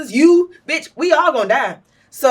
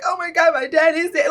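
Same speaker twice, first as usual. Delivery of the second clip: mock lament; 6 semitones higher than usual. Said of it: "not even so much like".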